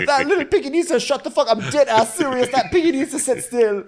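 very high, squealing protest; hammed-up falsetto whining in pain; pained patient. Injured [name] protesting the foot exam, high squealed mock outrage.

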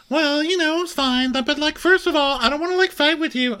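high mock [name] voice; [name] impression. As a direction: squeak it out like a flustered [name].

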